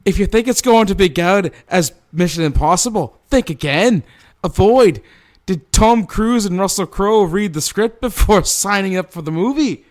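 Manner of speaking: high, loud, indignant proclamation; put-on high, forceful character voice; Mother Superior. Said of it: "Thank you, Mother Superior".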